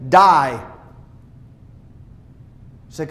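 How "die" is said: stern one-word command at the mirror; commanding die. Retold or imitated. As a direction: order your old self to die. Woman commanding old self to die.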